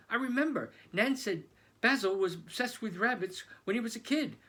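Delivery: high, loud rush of discovery; excited. Acted. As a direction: burst out with the realisation. Sudden realisation.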